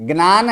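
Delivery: ज्ञान as "gnaan", with n after g; mispronounced ज्ञान. Shown as a demonstration. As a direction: offer ज्ञान as "gnaan", as if asking. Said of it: "ज्ञ का जो उच्चारण करते हैं वो गलत है". (ज्ञान को "ग्नान" बोलना)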